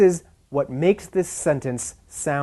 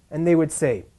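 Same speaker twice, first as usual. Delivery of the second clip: ordinary delivery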